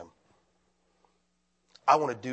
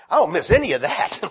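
laughing; 8 semitones higher, 8 dB louder than usual. Real, not acted. Relieved to leave church chores.

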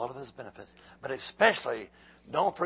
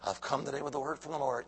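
ordinary talk, nothing marked

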